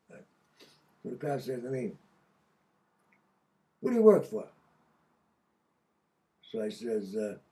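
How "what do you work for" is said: loud, high cop question; hammed-up interrogator demand; barking cop. Cop in the interrogation room, a loud pointed question.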